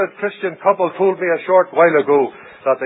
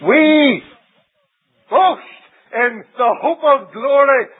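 proclaiming quote; 7 semitones higher, 4 dB louder, much slower than usual. Retold or imitated. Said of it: "He says".

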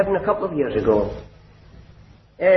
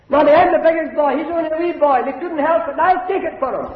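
put-on high childlike voice begging his mother; pleading little boy; 10 semitones higher than usual. Small boy begging his mother to spare his brother, high pleading voice.

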